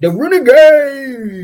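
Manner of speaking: high, loud, drawn-out cheer; stretched-out, rousing shout; team cheer. Real, not acted. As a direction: holler the team name like a fan. Parent cheers child's team name.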